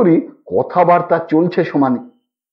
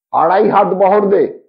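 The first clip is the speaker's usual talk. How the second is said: ordinary delivery, nothing marked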